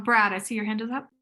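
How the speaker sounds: ordinary talk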